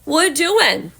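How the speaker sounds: loud, high-pitched pet talk; playful call to dog. Real, not acted. Owner suddenly calls to her dog, playful baby talk.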